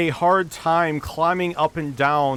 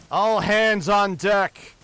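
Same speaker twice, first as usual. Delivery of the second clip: slow, drawn-out call, pitched higher; captain's mild yell; 5 semitones higher, much slower than usual. Acted. Captain summons the crew on deck, restrained yell. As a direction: call the crew up, stretching each word. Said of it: "they hear the captain yell".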